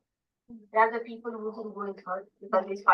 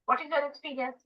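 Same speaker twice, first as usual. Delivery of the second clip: ordinary talk, nothing marked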